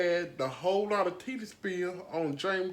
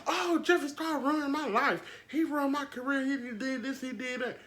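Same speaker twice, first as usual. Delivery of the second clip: high, whiny mock complaint; mocking James Charles; 7 semitones higher than usual. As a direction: ham up a pitiful victim voice. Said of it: "You had to say".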